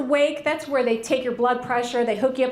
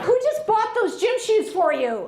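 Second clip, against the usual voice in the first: high, laughing, mock-offended; a laughing mock-outraged mom; sassy indignant mom; 6 semitones higher than usual. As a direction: rattle off your receipts with sass. Indignant mom listing what she paid.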